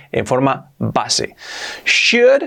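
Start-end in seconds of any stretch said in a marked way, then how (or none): 1.8-2.4 "should" pitched high and rising, said "shud"; correctly pronounced should. Shown as a demonstration. English teacher introducing the pronunciation, a high, clear "should" like "shud". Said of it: "importante la pronunciación".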